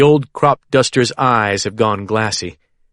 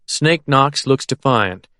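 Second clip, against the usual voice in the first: ordinary delivery, nothing marked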